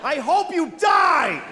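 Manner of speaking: high, loud, slow shouted curse; mock furious shout; road-rage curse. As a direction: spit it at the other driver. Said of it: "I said that to a person".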